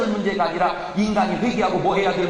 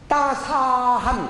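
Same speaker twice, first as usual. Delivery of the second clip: slow, higher, savoring 따사한; savoring word; 4 semitones higher, much slower than usual. Shown as a demonstration. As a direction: stretch out 따사한 lovingly and slowly. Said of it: "그건 뭐라 그래?".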